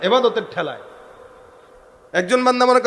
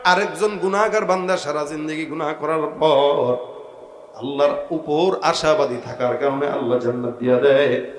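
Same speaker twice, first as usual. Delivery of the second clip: melodic admonition; 7 semitones lower than usual. Real, not acted. Preacher counsels against judging other servants, melodic admonition. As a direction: counsel them in a lowered preaching chant.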